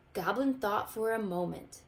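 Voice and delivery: ordinary delivery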